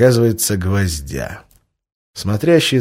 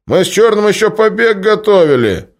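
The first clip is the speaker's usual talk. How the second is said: loud, high insistence; loud insisting; 13 semitones higher, 8 dB louder than usual. Acted. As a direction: push back loudly. Bashka insists to the boss.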